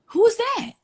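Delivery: loud, high-pitched astonishment; high, incredulous demand; startled question. Retold or imitated. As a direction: burst out, needing to know who. Startled question about stranger.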